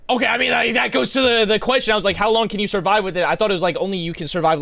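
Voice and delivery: high, loud, insistent outburst; worked-up loud reaction. Real, not acted. Streamer vindicated about his survival question, worked-up loud voice. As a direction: raise it nearly to a shout.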